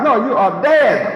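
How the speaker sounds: usual speaking voice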